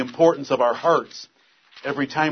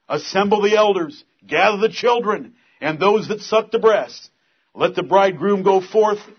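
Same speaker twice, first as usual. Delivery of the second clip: loud, high, fervent reading; proclaimed scripture; 6 semitones higher, 5 dB louder than usual. Acted. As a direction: call the nation to fast. Reading scripture at full force.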